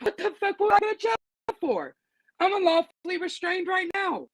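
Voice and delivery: high, fast, outraged comeback; incredulous retort. Retold or imitated. To the guard who threatened jail, a high outraged retort. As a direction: throw it back at him in disbelief.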